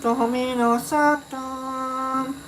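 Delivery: usual speaking voice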